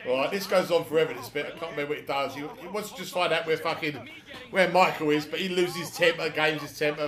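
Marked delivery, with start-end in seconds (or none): none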